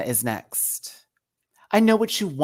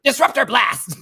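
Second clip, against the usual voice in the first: shouted villain attack; 7 semitones higher, 7 dB louder than usual. Retold or imitated. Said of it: "You gotta do Ultimate versus Marvel".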